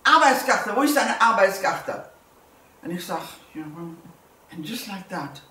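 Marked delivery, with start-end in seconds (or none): 0.0-2.0 shouted German demand, higher and louder; a retold yell for papers; retold scream. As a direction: bark it like an angry Gestapo officer. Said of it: "he screams at me again".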